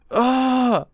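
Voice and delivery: loud, high cry of reaction; sudden high yelp; loud outcry. Real, not acted. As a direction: cry out at what happens. Outburst at the manga.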